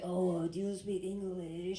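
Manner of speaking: slow, drawn-out mock French accent. French guy switches languages on her, slow hammed-up French accent. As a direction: play the Frenchman stretching out his English. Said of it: "went up to this French guy".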